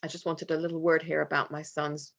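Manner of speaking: usual speaking voice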